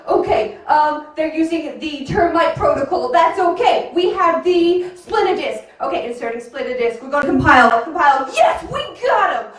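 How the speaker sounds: breathless action-movie hacking commentary; frantic movie hacker. Acted. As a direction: ham up a hacker fighting a virus live. Hacker narrating his hacking.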